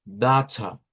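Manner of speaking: slow British butter with crisp t and no final r; British accent. Acted. Teacher imitating how a Briton says it, British accent. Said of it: "Un británico esto no los va a decir como".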